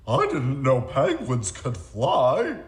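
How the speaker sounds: loud, slow, amazed exclamation. Acted. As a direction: gasp it out in wonder. Character amazed by flying.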